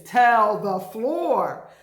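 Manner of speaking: slow, loud call to the room. Shown as a demonstration. Teacher calls to the class to shout the word. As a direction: tell the ceiling, loud and slow. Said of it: "call to the class".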